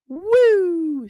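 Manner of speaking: sudden high whoop of glee; excited whoop. Real, not acted. Streamer whoops at her own mythology point. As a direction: let out a gleeful high woo. Excited cheer mid-chat.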